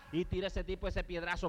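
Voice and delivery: usual speaking voice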